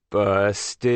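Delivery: drawn-out gloating taunt; teasing classmate. A narrator's reading. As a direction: stretch it out to tease a kid in trouble. Classmate teases boy called to office.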